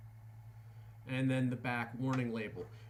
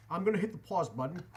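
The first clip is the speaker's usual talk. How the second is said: normal talking voice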